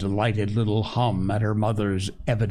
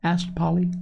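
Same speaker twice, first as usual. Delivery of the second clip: usual speaking voice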